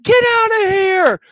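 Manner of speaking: full-force imitated shriek; screaming. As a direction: scream to drive something away. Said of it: "the other girl starts screaming".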